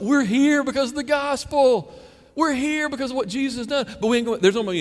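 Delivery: high, exclaiming celebration; imagined joyful outburst in heaven; acted rejoicing.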